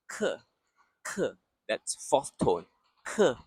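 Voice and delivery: Ke with a sharp falling drop; 课，第四声; fourth tone. Shown as a demonstration. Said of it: "a sharp drop".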